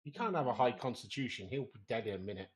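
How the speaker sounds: usual speaking voice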